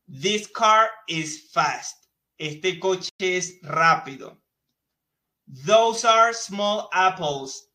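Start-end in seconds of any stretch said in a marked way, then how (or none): 0.1-2.0 very slow, deliberate English for learners; very slow model sentence. Shown as a demonstration. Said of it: "Miren cómo decimos acá". 5.7-7.7 slow, careful English with a put-on voice; slow model sentence. Shown as a demonstration. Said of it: "Miren cómo decimos acá".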